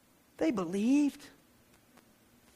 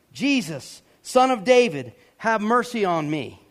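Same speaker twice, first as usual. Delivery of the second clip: raised voice, reading out a cry for mercy; mild shout; 3 semitones higher, 6 dB louder than usual. Acted. Preacher reading the blind beggar's cry, mildly shouted. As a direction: call out firmly without a full yell. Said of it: "he began to shout".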